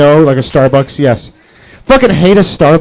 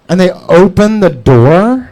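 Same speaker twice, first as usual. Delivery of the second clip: normal talking voice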